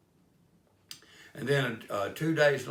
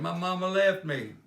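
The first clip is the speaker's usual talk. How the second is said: put-on higher and louder whine of a lost child; upset little boy; 9 semitones higher, 7 dB louder than usual. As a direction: plead like a frightened kid. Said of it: "started to cry".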